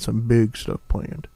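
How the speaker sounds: slipping into a Southern drawl; deep Southern accent. Real, not acted. Host hyping upcoming show plans, deep Southern accent. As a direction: let a Southern accent creep in. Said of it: "gradually go into that deep Southern accent".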